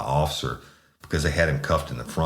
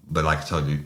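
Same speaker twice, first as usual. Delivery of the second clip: ordinary delivery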